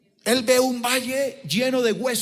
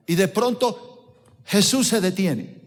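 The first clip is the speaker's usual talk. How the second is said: normal talking voice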